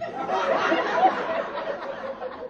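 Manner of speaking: a squealing burst of laughter; high breathless giggle; high airy laugh. Real, not acted. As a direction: laugh hard at your own joke. Laughs at the Uncle Porn text.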